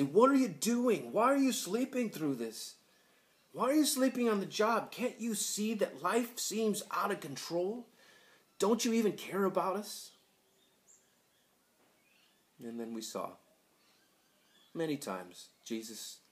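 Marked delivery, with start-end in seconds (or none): 0.0-10.1 high, loud, exasperated plea; urgent, high-pitched protest; indignant pleading cry. Acted. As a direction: cry out as if shaking a sleeper awake. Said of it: "shake Jesus and wake him up and say".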